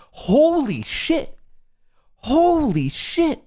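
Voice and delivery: high, slow, drawn-out holy shit; euphoric disbelief. Real, not acted. Streamer to chat as his trade pays off, giddy awe. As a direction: savour it in giddy amazement.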